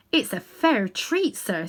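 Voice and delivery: a London cabby fumbling for words; cockney cabby. Acted. Cabby answers the great lion, humble cockney voice.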